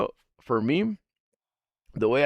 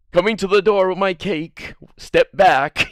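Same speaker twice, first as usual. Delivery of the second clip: calling out loud, pitched up; loud announcement; 6 semitones higher, 7 dB louder than usual. Pretend. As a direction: announce it loudly to the room. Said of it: "Nobody's really, like".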